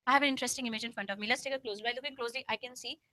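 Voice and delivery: calm, relaxed demo read. Shown as a demonstration. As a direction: read it steadily, no rush. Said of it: "I'll just go a little bit slow".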